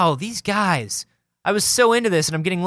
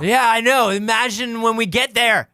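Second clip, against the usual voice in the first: higher, slow hammed-up drunk; drunk excited voice; 5 semitones higher than usual. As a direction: act giddy and wasted. Acted drunk road trip.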